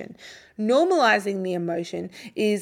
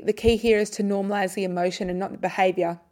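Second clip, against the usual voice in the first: ordinary talk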